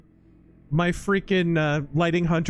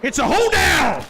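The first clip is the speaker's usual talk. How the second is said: excited shouting; 13 semitones higher than usual. Real, not acted. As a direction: yell it out in the heat of battle.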